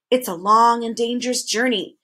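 plain reading voice

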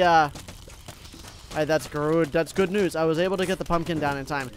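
ordinary talk, nothing marked

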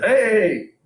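a high, loud "Hey!" like a friendly goat mayor; cheery goat greeting. Pretend. Goat mayor greeting visitors at the town entrance, loud cheery hello. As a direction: call out a bright welcome.

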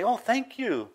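high-pitched mock surprise; surprised thanks. Stranger thanking you for opening the door, surprised. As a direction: imitate someone amazed by a small courtesy. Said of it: "And they're surprised".

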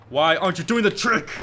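loud, high, exasperated yell at the game; frustrated. Real, not acted. Gamer yelling at his skater, frustrated and loud. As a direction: snap at the skater who won't do the trick. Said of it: "I'm getting frustrated".

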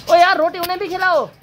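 calling out. Real, not acted. Calling to someone nearby.

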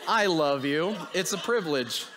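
laughing. Real, not acted. Joking apology to his wife.

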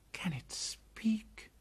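unvoiced, slow, breathy whisper. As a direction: whisper it in awe. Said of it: "said Mowgli in a whisper".

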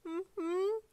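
very high hummed assent; flustered squeak. A narrator's reading. Speechless with a friend pressed close, high flustered hum. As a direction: squeak out a closed-mouth yes.

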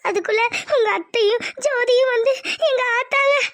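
breaking down into sobs; crying. Acted. Wife breaking down as she tells husband, sobbing. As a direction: start the sentence tearfully, then collapse into sobbing. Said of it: "அதுக்கு ஏன் அழுகுற நீ?".

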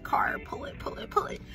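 sly whispered aside; whispering. Real, not acted. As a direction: whisper it like a secret. Gleeful aside about milking birthday.

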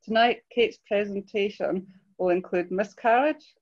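normal talking voice

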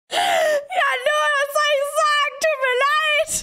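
high giggling sorry; giggly sorry to Noah; laughing apology. Real, not acted.

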